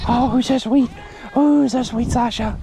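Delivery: high cooing to an animal; pet talk voice. Real, not acted. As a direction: coo at the goat. Cooing to a goat.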